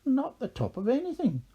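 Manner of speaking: high-pitched character retort; countering objection. A narrator's reading. Haggis keeps contradicting the leprechaun.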